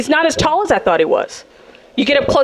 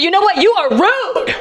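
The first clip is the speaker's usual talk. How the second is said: lightly voiced prissy high scold; a hint of a prim lady telling him off; 7 semitones higher, slower than usual. Telling off the driver who cut her off, mildly prissy. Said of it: "I caught up to him and I said".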